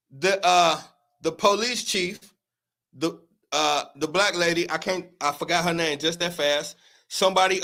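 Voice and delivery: normal talking voice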